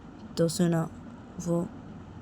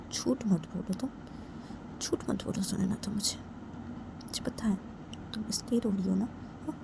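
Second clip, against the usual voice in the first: hushed confronting; 7 dB quieter than usual. Acted. Friend softly confronts her lie.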